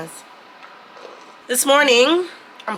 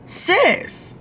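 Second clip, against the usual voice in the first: high, drawn-out "sis", fed up; exasperated "sis"; 11 semitones higher than usual. Real, not acted. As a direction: pitch it way up in disbelief. Venting to viewers, exasperated.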